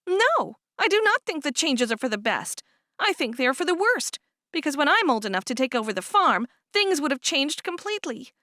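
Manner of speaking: lightly voiced young woman, complaining; voiced younger sister. Cherish argues with Florence.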